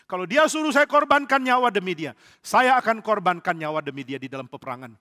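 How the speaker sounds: loud declaration, starting high; acted impassioned vow. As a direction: proclaim loyalty at full force. David vows loyalty to king.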